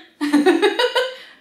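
high, loud laughing burst; storyteller's laugh. Real, not acted. Storyteller amused by his own joke, loud laugh.

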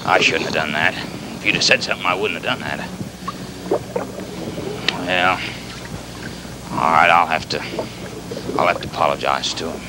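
low, soft, halting regret; subdued remorse. Acted. Captain regrets losing his temper with the animal, low and remorseful. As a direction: own up quietly, with long pauses.

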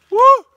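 short, loud, high-pitched whoop. Real, not acted. Tired hiker after complaining to Oscar, high whoop. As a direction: let out a loud "woo".

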